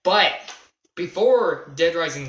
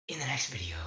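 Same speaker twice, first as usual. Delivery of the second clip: real breathy whisper to close the video; whispered sign-off; 4 semitones lower, 11 dB quieter, faster than usual. Real, not acted. Signing off to viewers at the end, whispered. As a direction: drop to a whisper on the last words.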